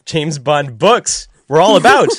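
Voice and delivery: laughing correction. Real, not acted. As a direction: fix "film" to "books" while laughing. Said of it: "hard to say the phrase James Bond without saying film".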